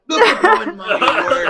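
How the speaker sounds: laughing. Real, not acted. Player mock declamation.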